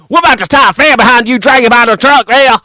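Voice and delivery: loud, high and fast Southern yelling; Southern cop shout. Retold or imitated. Streamer mocking Southern cops dragging a man from a truck, loud.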